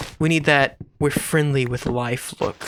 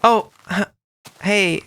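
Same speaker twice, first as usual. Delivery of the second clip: awkward date voice; 4 semitones higher, 3 dB louder than usual. Acted.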